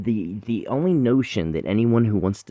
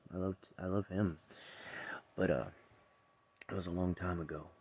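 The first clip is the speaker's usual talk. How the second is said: quiet regret; 7 dB quieter than usual. Real, not acted. Regret over baby brother Van.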